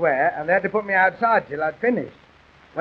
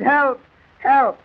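feeble little cry for help, pitched up; boy's half-hearted shout; 6 semitones higher than usual. Acted. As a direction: call for help without much volume. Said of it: "let me hear you shout now".

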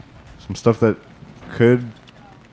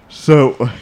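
ordinary talk, nothing marked

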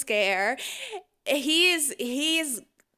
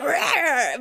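mock growl; 5 semitones higher, 7 dB louder than usual. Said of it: "he's like".

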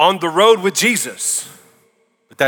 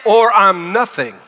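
raised, urgent and much higher, Jesus pleading with thousands; urgent preaching; 8 semitones higher, 4 dB louder than usual. Acted. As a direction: preach it hard to a huge crowd. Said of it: "there's an urgency in his voice".

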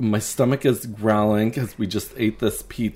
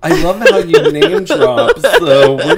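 laughing loudly, pitch high; 11 semitones higher, 11 dB louder than usual. Real, not acted. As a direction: burst out laughing while teasing. Laughing at name drop.

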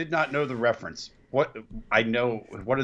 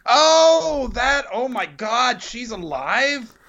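high, slow, aghast gasp; horrified disbelief; 13 semitones higher, 3 dB louder, much slower than usual. Real, not acted. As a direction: recoil in disbelief. Horrified by the photo.

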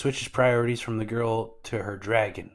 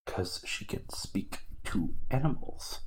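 slow hushed whisper; quick whispered side note; whispered aside; 3 dB quieter than usual. Real, not acted. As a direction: slip it in under your breath. Whispered aside about Apis.

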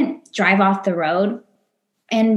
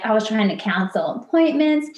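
ordinary talk

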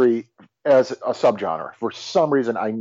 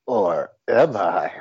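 mystery-play suspect; 5 semitones lower than usual. Acted. Associate hints to listeners he is the culprit, sinister. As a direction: lower voice, teasingly sinister. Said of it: "our little mystery play".

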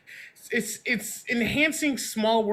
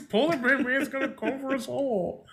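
lightly put-on alarmist voice, pitched up; mock doomsayer; 5 semitones higher than usual. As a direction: warn everyone in mock earnest, higher. Mock Al Gore warning from cartoon.

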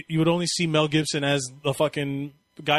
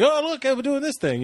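put-on much higher, bouncy, eager kid voice; eager excitement; 10 semitones higher than usual. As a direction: gush like an overexcited kid showing off. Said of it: "He's like".

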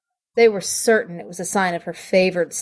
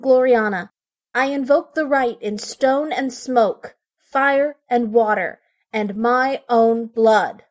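solemn incantation; 6 semitones higher than usual. Acted. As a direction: chant the invocation with awe.

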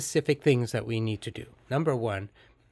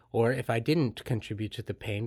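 ordinary talk

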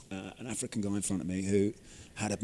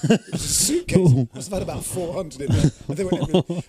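higher and much louder, laughing through it; excited laughing telling; 7 semitones higher, 11 dB louder than usual. Real, not acted. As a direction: burst out with the punchline, laughing. Laughing climax of anecdote.

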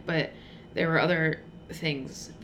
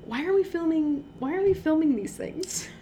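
exasperated; 10 semitones higher than usual. Real, not acted. Exasperated rhetorical question.

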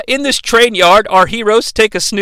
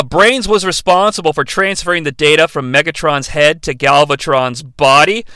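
usual speaking voice

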